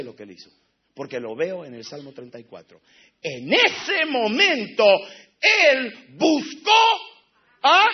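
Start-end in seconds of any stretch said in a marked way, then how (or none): 3.2-7.8 slow, rising to a loud shout; slow climb into a shout; building shout. Acted. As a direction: build it up and cry out. Preacher building to climax.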